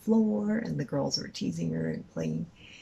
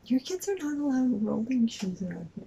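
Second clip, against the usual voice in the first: higher-pitched imitation of a store lady; mock scolding; 9 semitones higher than usual. Store lady telling a mother off about her kids, mock scolding. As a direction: put on a fussy store employee's voice.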